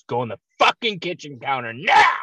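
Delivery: loud retold outburst, peaking on "now"; mock furious mom yell; mom's angry order. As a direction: imitate a mother exploding into a yelled command. Mother loses patience in story.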